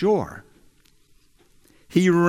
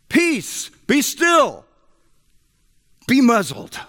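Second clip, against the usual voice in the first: commanding shout; 11 semitones higher than usual. Retold or imitated. Jesus rebuking the wind and waves, ringing commanding shout. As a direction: order the sea to be silent, full voice. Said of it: "He shouted to the sea".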